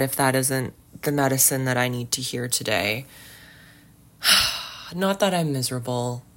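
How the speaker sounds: ordinary talk, nothing marked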